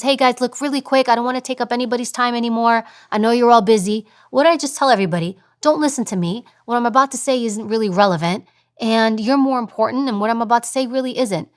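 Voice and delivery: really fast, apologetic. Shown as a demonstration. Colleague excusing herself to a busy meeting, rushed. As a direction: hurry through it as if taking up their time. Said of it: "when I speak really, really fast".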